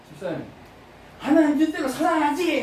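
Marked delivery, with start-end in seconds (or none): 1.2-2.6 eager resolve. Retold or imitated. Resolute vow quoted.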